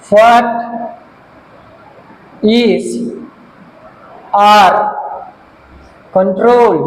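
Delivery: one word at a time, long gaps between; dictating while writing. Real, not acted. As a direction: say each word as you write it. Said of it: "সাত নম্বর লিখছি আমি". (লিখতে লিখতে একটা একটা শব্দ থেমে থেমে বলা)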